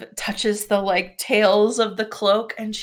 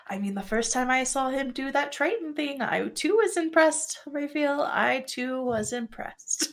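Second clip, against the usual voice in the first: higher, softer character voice, playfully impressed; lightly voiced Gris, admiring; 4 semitones higher, 7 dB quieter than usual.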